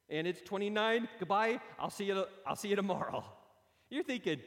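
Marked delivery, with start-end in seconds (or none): 0.1-3.2 hurried goodbye. Retold or imitated. Racing to beat the curfew rule.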